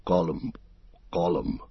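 a hint of a swallowed Gollum noise; throat noise. A narrator's reading. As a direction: catch the word in your throat. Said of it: "he made a noise in his throat".

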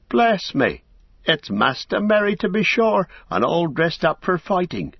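put-on a startled old gatekeeper hobbit. Old hobbit greeting Merry in surprise, high and fussy. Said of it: "said old Hob".

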